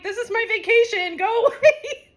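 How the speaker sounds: high, loud laughing whine; mock indignation. Friend acts out the dream Rachel sending her away, mock indignant. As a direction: shoo them off in playful exasperation.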